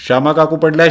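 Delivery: first cry of alarm; alarmed shout. Acted. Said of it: "असा ओरडा झाला".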